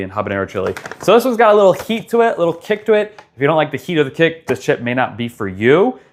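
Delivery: ordinary delivery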